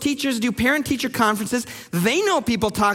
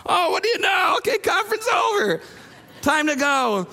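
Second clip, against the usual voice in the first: mother cutting parents off; 7 semitones higher than usual. Acted. Teacher hurrying parents out of the conference, mock-surprised. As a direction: pipe up brightly to end the meeting. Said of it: "to finally get those conferences over".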